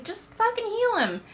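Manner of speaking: very high, loud sarcastic outburst; exasperated mock yell. Reviewer yelling at clueless servants, high exasperated mockery. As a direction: scream your frustration at the dumb servants. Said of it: "it's like".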